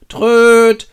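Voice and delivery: high, loud imitated horn; horn blast. Boromir sounds his horn, imitated loud toot. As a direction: toot one long blaring note.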